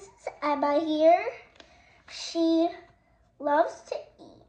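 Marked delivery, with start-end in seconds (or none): none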